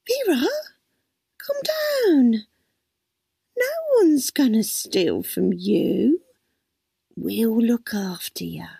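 slow, reassuring, fading to a hush; calm her gently; soothing. A narrator's reading. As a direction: carer soothing a panicking old lady, slow and gentle. Carer soothing panicked old lady.

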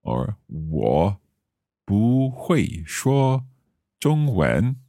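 slow 我不会说中文 with 不 in the rising tone; tone demo. Shown as a demonstration. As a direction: say 不会说 with a rising 不, slowly. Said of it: "So the bu changes".

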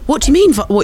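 shocked high squeak; high disbelieving outburst; incredulous. Real, not acted. Amazed he said vibe.

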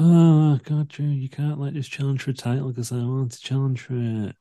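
high, mock whiny complaint; whiny Sheamus. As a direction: whine like a sulking wrestler. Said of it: "he looked like a little bitch".

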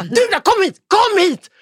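high, loud commanding yell, acted out; Pontus. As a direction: scream someone over in fury. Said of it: "bara skriker". (skriker argt och befallande)

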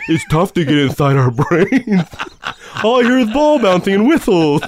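high, loud meathead voice; hammed-up dumb jock. Jock answering the scolding teacher, hammed-up dumb voice. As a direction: play a struggling dim jock, loudly. Playing a dim jock for laughs.

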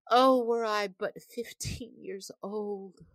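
put-on high, slow, yearning girl's voice; wistful young mermaid. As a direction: sigh it out like a dreamy young girl. Young mermaid's longing speech.

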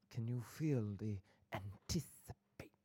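slow, drawn-out suspenseful whisper; whispered mock suspense; theatrical whisper. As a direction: tease them with a hammy whisper. Teasing audience with drawn-out suspense.

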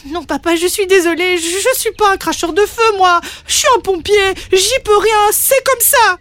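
high-pitched, pleading defiance; upset little dragon. Acted. Young dragon apologises yet stands firm to his father, distressed. Son refuses to obey father.

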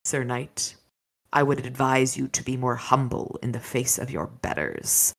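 low, soft warning to the knight; said quietly. A narrator's reading. Dragon Pharaoh warning Michael to be humble, quiet menace. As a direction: hush it, a calm quiet threat. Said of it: "then said quietly".

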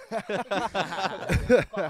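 slow, loud, laughing through words. Real, not acted. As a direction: crack up while teasing. Teasing friend, laughing loudly.

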